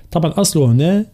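ordinary delivery

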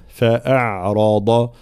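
slow فأعرض with thin hamza; light hamza. Shown as a demonstration. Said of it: "نفس الشيء في قوله تعالى". («فأعرض» بهمزة مرققة)

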